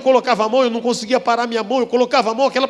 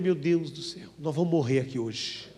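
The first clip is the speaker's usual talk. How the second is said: soft, low murmur of alarm; hushed panic; 7 semitones lower, 9 dB quieter than usual. Retold or imitated. Retelling his fear before singing, a hushed low aside. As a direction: mutter the panic under your breath.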